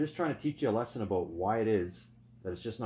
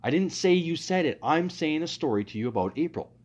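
higher and louder, fed up with her interrupting; exasperated, raised; 8 semitones higher, 8 dB louder than usual. Real, not acted. Boyfriend fed up with his girlfriend's interruptions, raised and exasperated. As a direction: snap back, exasperated. Cutting off partner mid-argument.

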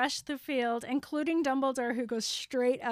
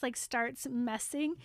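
normal talking voice

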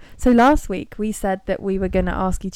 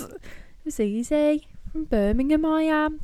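higher Birmingham accent, lines lifting at the end; Brummy accent; 5 semitones higher, 5 dB quieter than usual. Shown as a demonstration. Friend puts on her Birmingham accent for the podcast. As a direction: put on your Brummy voice. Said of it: "do you think I sound really different with this accent?".

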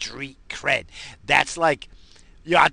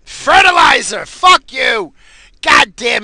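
sudden loud high yell of remembering; word finally found while high, yelled; triumphant shout; 11 semitones higher, 14 dB louder, slower than usual. Real, not acted. Stoned comic blurts the lost word out loud. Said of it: "pull the word out that I'm looking for".

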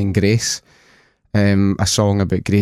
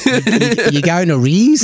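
high-pitched mock Kiwi accent; Kiwi impression; 9 semitones higher, 3 dB louder, slower than usual. Scot repeating his Kiwi pals' question in a high mock Kiwi accent. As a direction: put on an exaggerated New Zealand twang. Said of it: "I can do a good impression of Kiwis".

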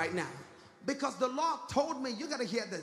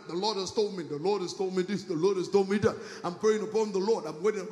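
mock pious excuse; 2 semitones lower, 6 dB louder than usual. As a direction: imitate a churchgoer hiding behind piety. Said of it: "as an excuse for everything".

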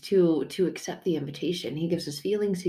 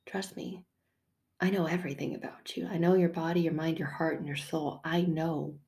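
soft reassurance; 5 dB quieter than usual. Acted. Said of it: "And he's like".

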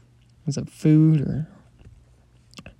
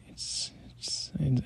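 scared whisper; 9 dB quieter, slower than usual. Acted. Scared of creature looking.

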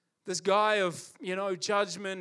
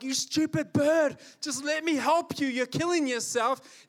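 higher, louder exasperated plea to a panicking bird; 7 semitones higher, 4 dB louder than usual. Retold or imitated. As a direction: scold the bird while trying to help. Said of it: "And you're like".

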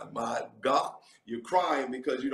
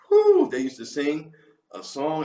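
ordinary talk, nothing marked

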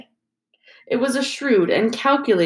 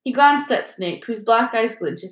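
ordinary delivery, nothing marked

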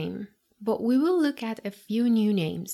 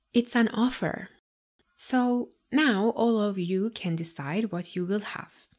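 ordinary talk, nothing marked

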